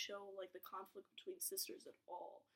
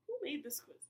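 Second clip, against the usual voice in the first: exasperated outburst; 6 semitones higher, 6 dB louder than usual. Real, not acted. Baffled by badly worded question.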